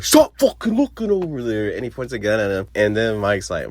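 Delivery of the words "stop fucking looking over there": high mock yell. Marcus snapping at Fletcher.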